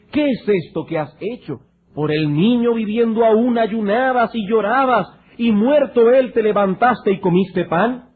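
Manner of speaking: mild challenge. Acted. Servants question David about eating after the death, mildly challenging. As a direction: challenge him as his puzzled servants would.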